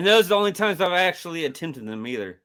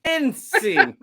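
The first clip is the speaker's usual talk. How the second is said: laughing; 13 semitones higher, 6 dB louder than usual. Real, not acted. Laughing at his own attempt.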